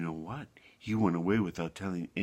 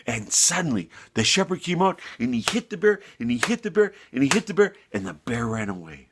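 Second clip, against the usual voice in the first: plain reading voice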